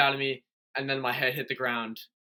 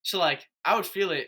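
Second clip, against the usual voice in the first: ordinary delivery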